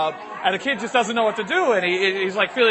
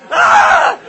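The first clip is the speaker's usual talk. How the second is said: very high, loud shriek; mother's scream; 14 dB louder than usual. Acted. Mother hears the word, screams. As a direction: let out a full-force scream. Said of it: "and she's like this".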